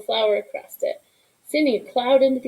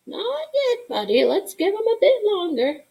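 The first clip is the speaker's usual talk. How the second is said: sing-song tease; 11 semitones higher than usual. Acted. Grandma teasing the waiting boy.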